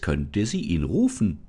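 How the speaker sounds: usual speaking voice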